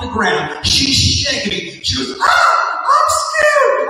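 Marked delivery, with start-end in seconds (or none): 2.2-3.9 very high, loud shriek of fright; shrieking scream. Retold or imitated. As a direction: imitate a terrified woman screaming. Big lady shrieks in haunted house.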